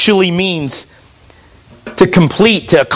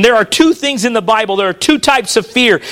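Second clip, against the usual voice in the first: normal talking voice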